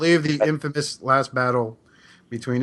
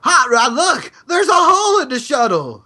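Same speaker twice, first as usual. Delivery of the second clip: Daniel; 11 semitones higher, 5 dB louder than usual. Retold or imitated. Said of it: "So Daniel's like".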